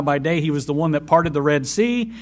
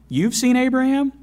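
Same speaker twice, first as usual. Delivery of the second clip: incredulous; 12 semitones higher, 4 dB louder than usual. Retold or imitated.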